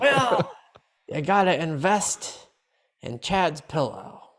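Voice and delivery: slow, drawn-out advisor voice; a werewolf hawking investments, slowly; finance werewolf voice. Pretend.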